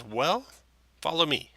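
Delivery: put-on high-pitched older halfling; halfling woman. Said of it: "And she says".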